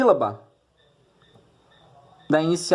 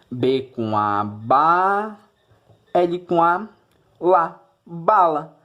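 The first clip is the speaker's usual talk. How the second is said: sounding out words; much slower than usual. Shown as a demonstration. Teacher sounds out syllables with student.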